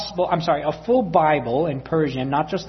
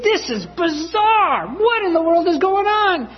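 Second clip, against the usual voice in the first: bewildered thought; 11 semitones higher than usual. Retold or imitated. Son baffled by mother refusing the Bible, high bewildered inner voice. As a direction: let the confusion rise into a high puzzled question. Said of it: "when I'm thinking".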